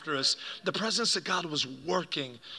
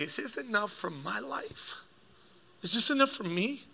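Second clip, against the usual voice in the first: soft, higher, halting question; near-tearful pleading; 4 semitones higher, 6 dB quieter than usual. Retold or imitated. Broken dad offers his money to God, pleading softly. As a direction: plead quietly with pitch lifted. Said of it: "who I'd never heard cry".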